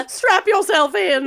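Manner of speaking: said through laughter; laughing. Real, not acted. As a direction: laugh while you say it.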